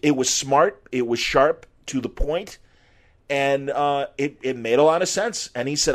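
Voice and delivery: ordinary talk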